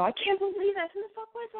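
high-pitched, disbelieving outburst; squeaky mock-horrified disbelief; re-enacted panic. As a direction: act out being aghast at an intruder. Acts out panic after intrusion.

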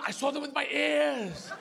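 high, slow, insistent dog voice; cocksure dog. Acted. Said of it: "as the character of the dog".

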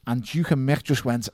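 ordinary delivery

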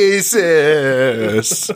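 drawn-out cheery attempt with a big smile; forced upbeat singsong; mock chipper smile. Shown as a demonstration. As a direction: stretch it out as if it were fun. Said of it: "saying it with a smile on the face".

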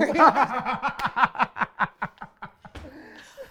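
open, ringing laugh; loud laugh. Real, not acted. Laughing at the story.

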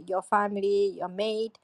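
ordinary talk

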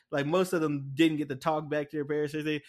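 usual speaking voice

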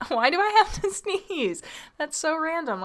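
high, laughing disbelief. Real, not acted. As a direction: giggle through the words. Laughing at an urge to sneeze.

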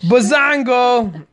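slow high triumphant Bazongo; playful shout. Pretend. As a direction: stretch out the victory word. Joking victory cry.

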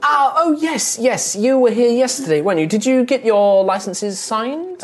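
high, fluttery official voice; a flustered clerk who recognises the newcomer; fussy clerk Daphne. Acted. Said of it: "Daphne looks up like".

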